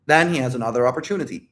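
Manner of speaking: ordinary talk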